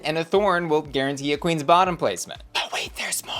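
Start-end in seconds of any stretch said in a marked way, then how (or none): 2.5-3.3 breathy unvoiced whisper, like a secret; whispered aside. To viewers, teasing the bigger twist, a sly whisper. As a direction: lean in and whisper the tease.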